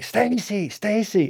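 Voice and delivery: high calling-out to the next room. Retold or imitated. Calling wife from bathroom.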